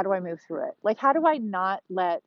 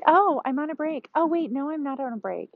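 higher mock teenage whine; mocking teen daughter; 7 semitones higher than usual. Mom imitating her teen daughter dodging chores, mock whine. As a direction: lightly parody a daughter's excuse. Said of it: "she's like".

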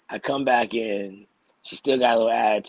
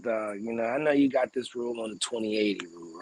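ordinary delivery

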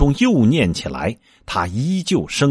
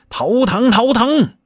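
high, louder pained cry; 13 semitones higher, 4 dB louder than usual. Acted. Monkey king yells as the headband tightens, high pained cry. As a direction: yelp as a spell squeezes your head. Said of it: "行者叫道".

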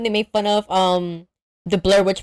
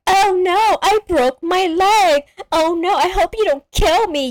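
mock whiny victim; 10 semitones higher, 3 dB louder than usual. Shannon Elizabeth to the killer, high whiny plea. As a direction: whine it like a ditzy victim.

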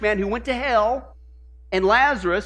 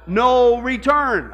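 "No return" stretched slow with weight on "No"; preacher landing the answer "No return" slow and heavy; slow emphasis; 5 dB louder, much slower than usual. Acted. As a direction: drag out "No return" and lean on "No". Fill-in quote answer.

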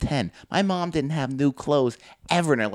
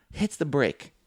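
usual speaking voice

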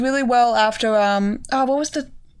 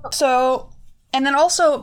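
normal talking voice

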